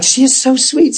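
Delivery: high, sugary gushing; playful mimicry of a doting churchgoer; gushing admirer. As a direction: coo it like you adore her. Mimicking admirers of Sister Sally.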